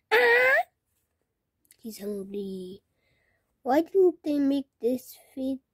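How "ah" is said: mock scream. Shown as a demonstration. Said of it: "Screaming face".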